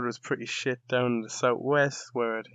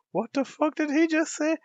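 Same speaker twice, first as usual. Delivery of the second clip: very high, louder mock shock; stunned disbelief; 6 dB louder than usual. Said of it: "I was like".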